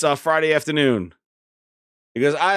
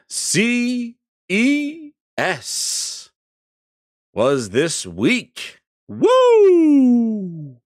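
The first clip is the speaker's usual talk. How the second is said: hyped up; 6 semitones higher, much slower than usual. Real, not acted. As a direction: stretch it then whoop. Hyping CES week.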